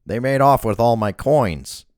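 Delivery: loud, complaining nasal thug. A narrator's reading. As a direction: gripe it through the nose. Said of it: "the nasal voice".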